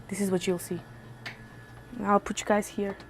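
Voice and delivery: ordinary delivery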